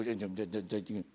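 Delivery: usual speaking voice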